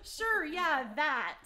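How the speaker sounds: loud teasing imitation of a hesitant yes; mocking unsure agreement. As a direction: parody a friend's unconvinced agreement. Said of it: "I think that's what you".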